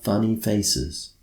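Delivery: ordinary delivery, nothing marked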